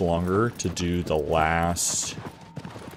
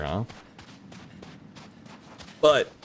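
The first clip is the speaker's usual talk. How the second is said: normal talking voice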